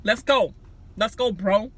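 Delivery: mock angry hype. Nick storming out the room, loud hype. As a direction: storm off hyping yourself up. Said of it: "He stormed out the room".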